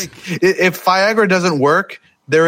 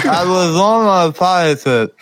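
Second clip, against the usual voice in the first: slurred through laughter, tongue held; holding tongue, laughing; 3 semitones higher than usual. Shown as a demonstration. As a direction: laugh while pinching your tongue. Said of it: "if you hold your tongue and say".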